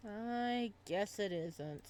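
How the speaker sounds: drawn out, deflated admission; reluctant agreement; slow concession. Acted. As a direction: give in slowly. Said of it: "concedes reluctantly".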